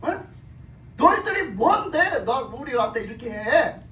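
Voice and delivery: acted indignation, swelling on the repeated challenge; confrontational challenge. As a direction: imitate rebels calling leaders to account. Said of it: "딱 불러놓고 따지는 거예요". (따져 묻는 반란자 흉내)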